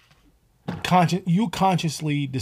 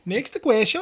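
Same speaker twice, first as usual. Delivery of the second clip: bad mock Italian accent, pitched higher; horrible Italian accent; 7 semitones higher, slower than usual. Podcast host announcing the next question in a botched Italian accent. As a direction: ham up a terrible Italian accent. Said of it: "That was a horrible Italian accent".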